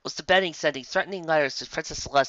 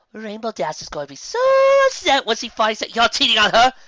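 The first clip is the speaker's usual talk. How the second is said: Missus Cake's voice; 10 semitones higher, 7 dB louder than usual. Acted. Missus Cake scandalized.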